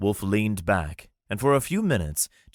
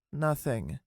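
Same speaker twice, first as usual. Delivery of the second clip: grumbled; 7 semitones higher, 5 dB quieter than usual. Acted. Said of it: "Saith grumbled".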